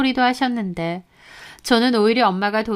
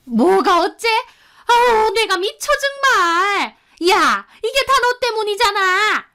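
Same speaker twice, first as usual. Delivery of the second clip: furious, much higher and louder shriek of outrage; angry mother-in-law; 11 semitones higher, 4 dB louder than usual. A narrator's reading. Mother-in-law scolding her daughter-in-law over the phone, furious high outburst. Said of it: "또 저한테 화를 내시는 겁니다".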